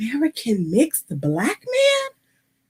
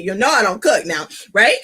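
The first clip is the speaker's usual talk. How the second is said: ordinary talk, nothing marked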